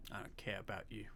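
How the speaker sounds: hushed, whispered mock dismissal; very soft, teasing aside; mock snub whisper. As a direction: whisper it under your breath as a joke. Joking snub to viewers, muttered aside.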